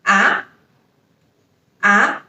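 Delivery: ah rising upward; second tone ah. Shown as a demonstration. Teacher modelling the rising second tone. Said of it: "so the pronunciation is".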